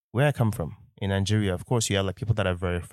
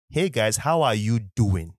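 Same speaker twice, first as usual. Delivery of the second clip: slow, measured Nigerian English; slow right down into a Nigerian accent; 2 semitones higher, 3 dB louder, slower than usual. Shown as a demonstration. Podcaster showing his other self to listeners, Nigerian accent. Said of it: "like, two people inside of me".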